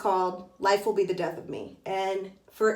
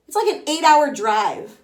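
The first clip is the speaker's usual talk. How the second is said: excited; 6 semitones higher, 7 dB louder than usual. Real, not acted. Amazed at short distance.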